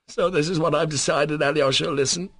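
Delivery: urgent, just held in check; mastering his emotion. Acted. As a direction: push through the feeling. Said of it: "mastering his emotion".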